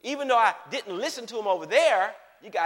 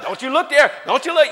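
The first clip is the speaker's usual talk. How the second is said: urgent self-scolding; 5 semitones higher, 10 dB louder than usual. Retold or imitated. Scolding himself at beach.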